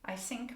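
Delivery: "I sink" with a plain s where th belongs; wrong s for th. Shown as a demonstration. Teacher shows the th-to-s mistake in "I sink". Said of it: "if you say sink".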